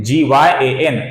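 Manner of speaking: ordinary talk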